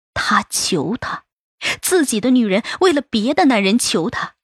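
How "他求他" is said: hissing whisper. A narrator's reading. Narrator drops to whisper.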